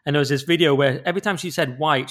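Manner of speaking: usual speaking voice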